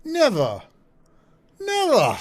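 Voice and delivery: high, loud cries of mock disbelief; mock indignation. Host scoffs at politician's claim of moles, mock indignation. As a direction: exclaim it shrilly in hammed-up denial. Sarcastic reply to clip about moles.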